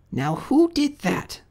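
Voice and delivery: very high, slow, cross character voice; demanding culprit's name. Acted. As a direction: demand an answer in a high pitch. Cross voice after the crash.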